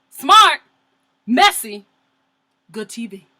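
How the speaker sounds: gleeful; excited verdict. Real, not acted. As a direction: relish the drama. Reality tv verdict.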